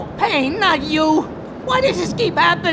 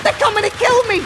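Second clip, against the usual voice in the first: panicked cry; 7 semitones higher, faster than usual. Acted. Vinny panics as enemies arrive.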